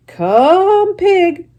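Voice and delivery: high, loud coaxing call; farmer calling. Acted. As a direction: call the pig to the pail.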